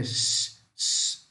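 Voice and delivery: quiet sh hiss on "x"; soft sh hiss; SH sound. Shown as a demonstration. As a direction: say "x" as a voiceless sh. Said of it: "Som de C H".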